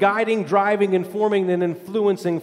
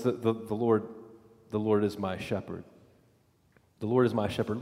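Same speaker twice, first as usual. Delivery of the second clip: hushed confession; 10 semitones lower, 9 dB quieter than usual. Acted. Repeats the psalm as submission.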